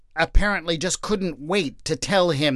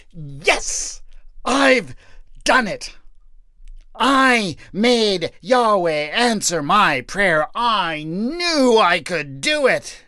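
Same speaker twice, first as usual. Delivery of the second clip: triumphant whoop; 5 semitones higher, slower than usual. Acted. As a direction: crow over his victory. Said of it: "He whooped and cried out".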